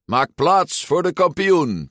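high, swaggering announcement; proclaiming. A narrator's reading. Ercole clears the square for himself, proclaiming. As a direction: proclaim it like a self-styled champion. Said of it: "verkondigde Ercole".

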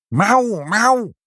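high, loud cat yowling; shrieking, drawn-out meows; piercing cat meow. A narrator's reading. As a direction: meow urgently like two cats. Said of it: "hieven een doordringend geschreeuw aan".

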